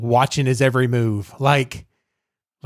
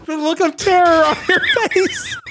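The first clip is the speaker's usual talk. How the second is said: laughing; 3 dB louder than usual. Real, not acted. Host laughing at cohost's reaction.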